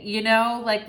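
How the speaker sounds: ordinary talk, nothing marked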